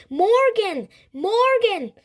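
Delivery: very high, loud calls; yelling. A narrator's reading. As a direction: yell the name to snap him out of it. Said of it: "Annie yelled".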